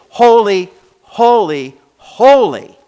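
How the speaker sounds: each HOLY loud and separate, ending high; preacher's ringing, hammered "holy"; emphatic repetition. Real, not acted. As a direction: punch every "holy" harder, with a gap between. Climax of not just holy.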